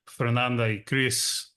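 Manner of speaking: normal talking voice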